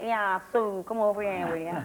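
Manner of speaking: a hammed-up, slow fake Manchester accent; bad Manchester accent. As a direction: drawl it like a bad Mancunian impression, slowly. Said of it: "we do the worst Manchester accents".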